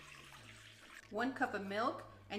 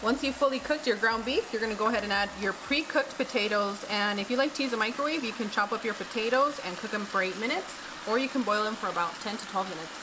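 cooking show voiceover; 5 dB louder than usual. Acted.

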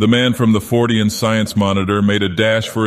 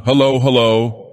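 raised calling voice; calling into microphone; 4 semitones higher, 3 dB louder than usual. Acted. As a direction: call out hello into a mic.

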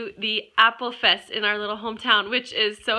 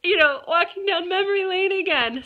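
nostalgic gushing; 9 semitones higher than usual. Retold or imitated. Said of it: "so nostalgic to be like".

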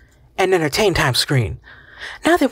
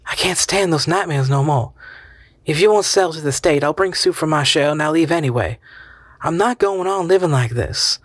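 weary but resolute; 5 semitones higher than usual. Acted. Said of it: "her voice weary but resolute".

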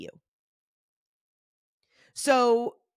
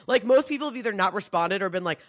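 normal talking voice

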